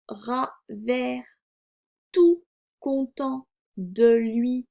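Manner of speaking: very slow, word-by-word reading; slow reading aloud. A narrator's reading. Teacher models reading for a beginning reader, slow word-by-word. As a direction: read deliberately with gaps between words.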